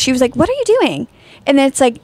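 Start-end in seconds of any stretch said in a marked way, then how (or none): 0.3-1.1 higher-pitched, concerned imitation; imitating concern. Host showing how the sleeper said it, imitated concern. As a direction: lift the pitch, mimicking a worried question. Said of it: "how she said it".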